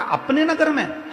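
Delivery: very high, incredulous echo; astonished king's reaction; shocked question. Acted. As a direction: question it in acted disbelief, pitch leaping up. King reacts to shocking news.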